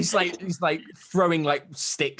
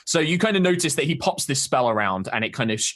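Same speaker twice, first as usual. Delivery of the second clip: usual speaking voice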